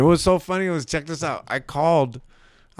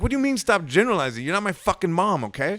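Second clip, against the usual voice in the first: retold exasperated comeback, pitched high; replayed outburst with a high pitch; snapped; 8 semitones higher, faster than usual. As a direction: snap back in indignant disbelief. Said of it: "I just snapped".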